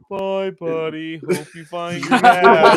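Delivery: slow, put-on little kid voice; drawn-out childish farewell; little girl voice. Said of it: "And she goes".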